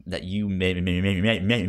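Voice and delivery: ordinary talk, nothing marked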